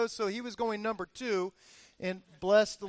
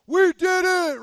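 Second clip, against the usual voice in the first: loud, high victory cry; acted-out summit celebration; triumphant cheer; 9 semitones higher, 10 dB louder, slower than usual. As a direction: whoop it out like you just conquered the peak.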